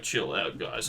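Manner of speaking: low, soft, laid-back imitation; cool TV reporter. Reporter settles excited old ladies, imitated cool calm. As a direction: drop deep and quiet to calm them. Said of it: "And he's like".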